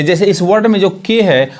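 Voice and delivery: usual speaking voice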